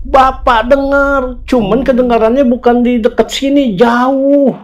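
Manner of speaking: high-pitched, stretched-out explanation; lightly voiced elder. Neighbourhood head recalling a distant scream, imitated in amazement. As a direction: voice the elder high, drawing out key words.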